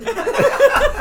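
loud, high, breathy laugh; high burst of laughter. Real, not acted. Friend finishing the cop story, loud high laugh. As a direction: let out a big squealing laugh.

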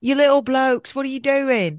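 high-pitched fake British scolding; mock British accent. Joining friend's bit, put-on British accent. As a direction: tease them in exaggerated British. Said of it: "any more of their expressions".

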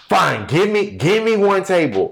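high, exasperated giving in; arguing waitress. Retold or imitated. Waitress relenting to the hostess, high argumentative voice. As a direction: act out a grudging, high-pitched concession. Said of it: "the hostess and one of the waitresses were arguing".

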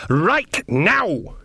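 high, emphatic maternal order; stern command. A narrator's reading. Nanny orders her guard son to obey, sternly. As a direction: order it with force on each word.

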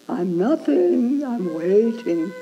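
slow, bored mock sigh of waiting; lightly mocked passive sitter. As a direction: drag it out like someone idly waiting. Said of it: "It's not just being passive".

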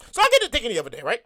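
ordinary talk, nothing marked

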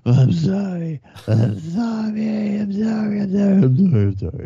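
high, loud, mock-blubbering apologies, each one climbing; imitating sobbing. Drunk suspect begging the officer at gunpoint, hammed-up sobbing. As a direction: ham up a drunk suspect sobbing sorry over and over. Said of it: "And he's sobbing".

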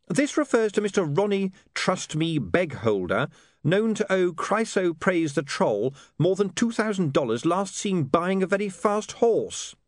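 put-on high-pitched young newsman reading out a report; William, reading aloud. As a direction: read the story out pointedly. William reads news item aloud.

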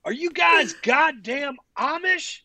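high, slow, drawn-out mock holler; imitated drunken yelling. Drunk fan to tired sportswriters at three a.m., mock yelling. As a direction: wail it in exaggerated disbelief. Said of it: "just yelling at us".

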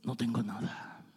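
very soft, low, near-whispered plea; hushed testimony. Acted. Believer confessing hardship to God, hushed testimony. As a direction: drop to a hush, acting out a believer in need.

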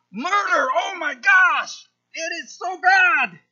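shouted mock horror at murder; mock outrage. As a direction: overplay the scandalised believer. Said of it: "selective indignance".

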